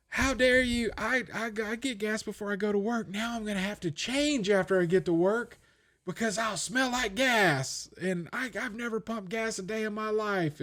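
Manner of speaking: high soft parody of offended outrage; mock outraged commenter.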